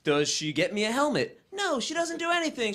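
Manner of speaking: ordinary talk, nothing marked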